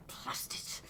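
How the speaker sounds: a cursing whisper under your breath; whispered grumble. Acted. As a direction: whisper it, annoyed, to yourself.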